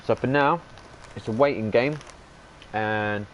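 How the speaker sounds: talking while eating crisps; crunching chips. Real, not acted. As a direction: mouth full of crisps. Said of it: "sorry, I'm eating crisps".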